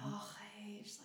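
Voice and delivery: whispered in awe at a sunset; awed whisper. Shown as a demonstration. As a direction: let it out as a hushed sigh. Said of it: "Or when you see a sunset".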